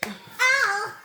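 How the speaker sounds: sudden high playful ow; playful yelp. Real, not acted. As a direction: yelp it out in play. Child cries out in play.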